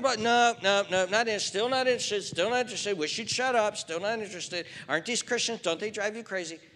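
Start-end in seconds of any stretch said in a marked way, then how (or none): 0.0-4.7 loud, high, driving sermon voice; full-force preaching. Acted. Pastor pressing the congregation about sin, loud preaching.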